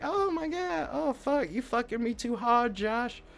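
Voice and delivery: high-pitched mock moans of protest; put-on high voice, complaining mid-moan. Voices woman moaning during sex.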